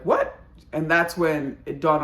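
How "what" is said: shocked what. Retold or imitated. Wife shocked by the news.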